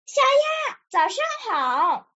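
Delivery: high-pitched call to a friend; storybook shout across the water; calling out loudly. A narrator's reading. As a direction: call out a greeting, first word high, then stretched. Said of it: "弗洛格大声喊道".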